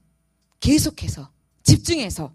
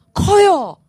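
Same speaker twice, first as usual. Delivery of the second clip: emphatic answer; 6 semitones higher, 10 dB louder, much slower than usual. Real, not acted. Congregation call and response.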